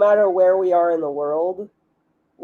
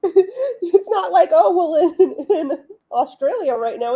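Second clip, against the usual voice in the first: high-pitched, laughing explanation; 8 semitones higher, 3 dB louder than usual. Real, not acted. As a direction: try to explain through giggles. Cracking up while explaining.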